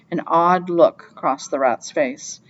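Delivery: plain reading voice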